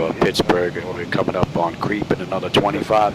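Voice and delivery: ordinary talk, nothing marked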